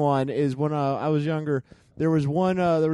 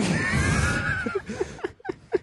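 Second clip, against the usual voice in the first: a squealing burst of laughter, way up high; high shrieking laugh. Real, not acted. Telling the grandpa story, breaks into a shrieking laugh. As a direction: let a wild shriek of a laugh out.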